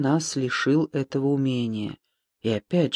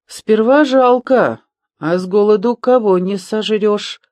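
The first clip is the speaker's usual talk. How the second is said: sighed remark; 7 semitones higher, 6 dB louder than usual. A narrator's reading. Коптилка to squeamish friends about eating gophers, sighing loudly. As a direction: sigh it out high and loud. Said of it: "вздохнул Коптилка".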